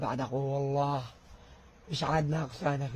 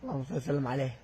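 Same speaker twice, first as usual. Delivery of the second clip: ordinary talk, nothing marked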